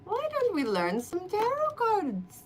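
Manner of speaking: mock sugary coaxing of a bored ghost. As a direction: sweet-talk her into tarot. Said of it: "I said".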